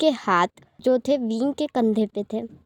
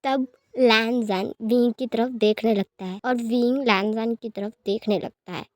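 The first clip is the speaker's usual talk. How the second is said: ordinary delivery, nothing marked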